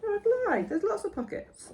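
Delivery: high, laughing embarrassed. Real, not acted. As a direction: giggle it out sheepishly. Caught out, laughing.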